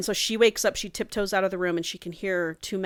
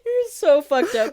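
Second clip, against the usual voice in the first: said through laughter, slower and high; laughing; 10 semitones higher, 5 dB louder, slower than usual. Real, not acted. Scolding her co-host while still laughing. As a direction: laugh the words out.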